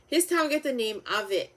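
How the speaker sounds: usual speaking voice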